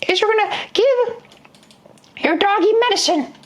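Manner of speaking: put-on very high, old-lady falsetto; a squeaky grandma instructing the kid; granny voice. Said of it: "And Granny says".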